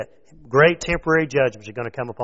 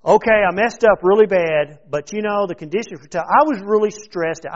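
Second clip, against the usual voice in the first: higher and louder, pleading excuses; whiny excuse-maker; 7 semitones higher, 7 dB louder than usual. Pretend. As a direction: beg the Lord while shifting blame. Sinner making excuses to God.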